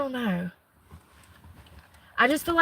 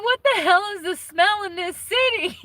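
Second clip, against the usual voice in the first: squeaky, indignant retelling of his own complaint; high mock outrage; 9 semitones higher than usual. Talking to viewers, retelling his own disgust at the city smell, high and outraged. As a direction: pitch it way up, like a scandalized version of yourself.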